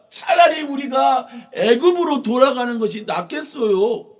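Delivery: loud, high whining complaint; loud grumbling people; complaining crowd. Acted. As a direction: grumble loudly at Moses, high and shrill-free. Israelites complaining in Bible story.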